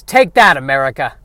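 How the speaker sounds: hammy villain bunny taunt; cartoon bunny sneering at the country; war bunny. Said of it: "the war bunny who says".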